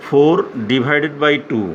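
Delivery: four divided by two drawn out word by word; slow English read-out. Shown as a demonstration. Teacher showing pupils how to read division in English, slow. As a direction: say it slowly so a child can copy it. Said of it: "আর এখন বলবো আমরা".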